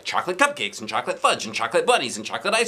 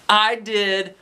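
bragging; 10 semitones higher, 3 dB louder than usual. Acted. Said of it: "Ryan bragged".

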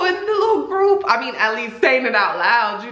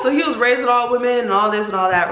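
usual speaking voice

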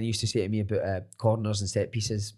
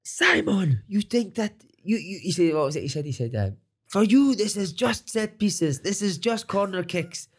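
higher, slow, earnest foreign coach voice; imitating the coach; 6 semitones higher, slower than usual. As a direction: mimic the manager explaining solemnly. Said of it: "one day he was like".

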